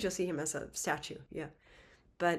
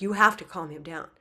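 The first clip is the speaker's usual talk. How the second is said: louder, pressing repeat of the plea; insistent plea; 7 dB louder than usual. Acted. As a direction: push hard, now they insist. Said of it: "They're like".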